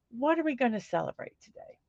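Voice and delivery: a soft, quick repeat to herself. Shown as a demonstration. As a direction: mutter the question again under her breath, quickly.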